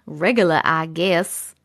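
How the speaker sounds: low, slow New York drawl; New York accent. Shown as a demonstration. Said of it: "My, my New York accent".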